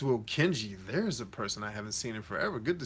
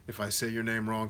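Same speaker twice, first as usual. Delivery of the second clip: normal talking voice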